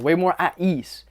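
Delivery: ordinary talk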